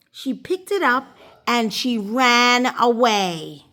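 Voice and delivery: usual speaking voice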